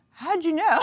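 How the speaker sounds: high, loud, caught-out question; mock-shocked outburst; retold startled teen. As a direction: imitate a kid who's been found out. Voices startled teenager caught out.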